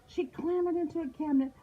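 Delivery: high-pitched, urgent story reading; high, frantic storytelling; panicked narration. A narrator's reading. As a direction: tell the chase in a high, breakless panic.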